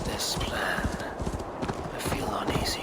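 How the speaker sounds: a private worry, barely voiced; whispered doubt. Eivor to herself before the assault, whispering. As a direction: whisper it under your breath. Quiet misgiving before the battle.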